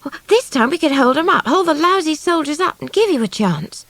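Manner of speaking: high, eager rush; excited. A narrator's reading. Swallow offering to stop the soldiers, excited. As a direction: pitch up, keen to help. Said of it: "Swallow said excitedly".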